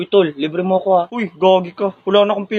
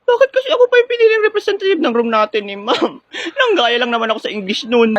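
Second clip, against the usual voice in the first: high, wailing, tearful whine; whiny fake crying; 5 semitones higher than usual. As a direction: whimper about being picked. Chosen representative whining near tears. (umiiyak na reklamo)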